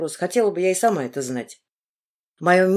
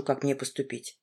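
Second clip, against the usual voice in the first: plain reading voice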